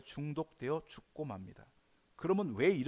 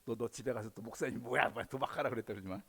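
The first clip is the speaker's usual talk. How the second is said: sneaky devil; 4 semitones lower than usual. Acted. Devil warning child, altered voice.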